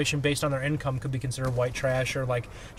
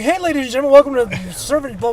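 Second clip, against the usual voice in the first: loud, high, fast show opener; hyped host intro; 9 semitones higher, 8 dB louder than usual. Retold or imitated. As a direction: blast out the welcome. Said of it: "every week it's me going".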